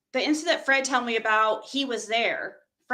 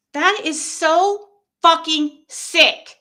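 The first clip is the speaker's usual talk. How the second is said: loud, slow, higher-pitched indignation; outraged disgust; 4 semitones higher, 6 dB louder, slower than usual. Real, not acted. Reacting to someone accessing her account, loudly disgusted. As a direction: punch each word slowly in outrage.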